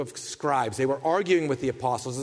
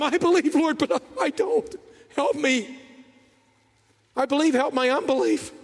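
high, broken, pleading confession; anguished father; 9 semitones higher than usual. Retold or imitated. Father answering Jesus, high and pleading. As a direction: stumble through it like a torn father. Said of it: "Jesus has just said".

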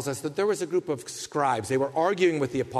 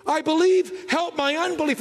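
higher, louder cry; fervent plea; 11 semitones higher, 3 dB louder than usual. Retold or imitated. Father crying out to Jesus, high and loud. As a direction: call it out with urgency. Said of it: "more honest confession".

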